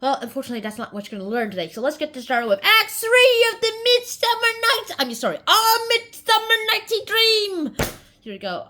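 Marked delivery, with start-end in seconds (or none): 2.6-8.0 high, raised title announcement; mock announcer. Host grandly announcing Midsummer Night's Dream, mock announcer. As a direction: belt out the title like a showy announcer.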